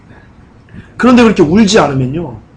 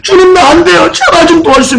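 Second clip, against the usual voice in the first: much higher, louder crying out to God; desperate wailing plea; 11 semitones higher, 3 dB louder than usual. Acted. A believer wailing to the Lord that they cannot do it, desperate crying. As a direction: cry out like you're rolling on the floor in anguish. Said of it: "막 떼굴떼굴 구르는 거예요".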